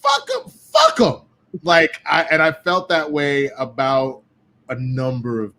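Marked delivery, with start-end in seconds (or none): 0.0-1.2 building to a very high, drawn-out mock yell; acted outrage. Veteran instantly turning on a rookie, acted outrage. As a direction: let the mock dismissal climb into falsetto.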